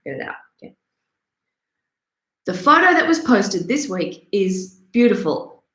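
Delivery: normal talking voice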